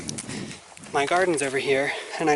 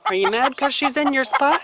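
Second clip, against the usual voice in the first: talking to a pet; 8 semitones higher than usual. Real, not acted. Talking to a hen.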